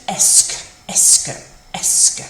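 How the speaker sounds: "est-ce" as one loud "esk" syllable, repeated separately; pronunciation demo. Shown as a demonstration. Teacher showing it is not hard, "est-ce" pronounced "esk". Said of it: "isn't too bad to pronounce".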